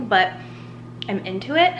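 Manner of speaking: ordinary delivery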